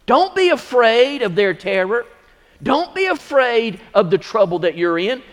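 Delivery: high, loud, rising pulpit preaching; preaching at full force. Acted. Pastor rallies brothers and sisters against the devil, shouting. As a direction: fire up the church, building to a shout.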